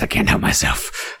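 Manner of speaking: ordinary delivery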